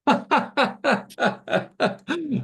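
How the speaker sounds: high, loud burst of laughter; big laugh. Real, not acted. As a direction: crack up in a long high laugh. Host cracks up at guest's trepidation.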